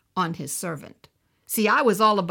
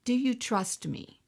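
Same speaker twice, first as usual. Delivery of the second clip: soft, slow, tender question; gentle voice of God; 4 semitones lower, 6 dB quieter than usual. Retold or imitated. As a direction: imitate the Lord asking softly and patiently. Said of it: "the Lord began to say".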